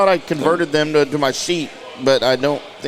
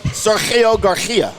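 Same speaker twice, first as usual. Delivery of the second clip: put-on very slow, higher-pitched Spanish accent; 5 semitones higher, much slower than usual. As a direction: draw the name out in a Spanish accent, falling at the end. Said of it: "That's the best accent you've done".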